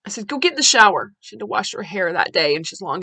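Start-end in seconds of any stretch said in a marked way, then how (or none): 0.3-1.1 stern order. Retold or imitated. Mother shouting at daughter.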